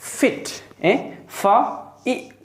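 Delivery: fit split into Fa and it; sounding out fit. Shown as a demonstration. Teacher sounding out fit slowly. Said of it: "another word is".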